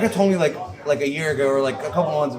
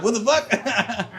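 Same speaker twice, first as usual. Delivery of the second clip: mock shock; 8 semitones higher, much slower than usual. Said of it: "you're like".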